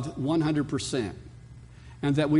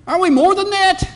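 much higher, much louder cry; preacher's shout; 10 dB louder than usual. Real, not acted. Pastor challenging his congregation at the climax, shouting. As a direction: throw the question to the back of the hall.

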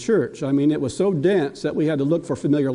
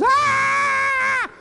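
put-on very high, sudden toddler shriek; a baby's piercing yell; baby's shout. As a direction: grandpa imitating the toddler shrieking at him.

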